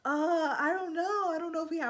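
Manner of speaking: high, hesitant fake cluelessness; playing dumb. Salesman playing dumb with the buyer, high and hesitant. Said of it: "act like you don't know".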